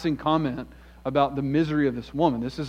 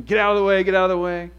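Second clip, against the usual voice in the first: raised, higher mock shout of get out of the way; imagined yell at the crowd; 5 semitones higher, 6 dB louder, faster than usual. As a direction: push through the crowd with a pretend holler. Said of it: "I'm yelling, I'm screaming".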